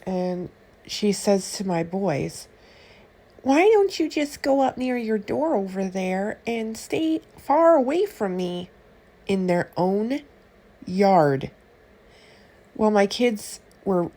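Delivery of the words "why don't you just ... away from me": curt stranger. Retold or imitated. Rude stranger shooing the boys away.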